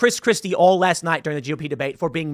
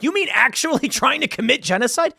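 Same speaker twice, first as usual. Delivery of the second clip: higher, laughing mock disbelief; mock incredulous; 8 semitones higher than usual. Congresswoman grilling the president, hammed-up incredulous question. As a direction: throw her pointed question back, half laughing. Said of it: "went a little heavy with it when she was like".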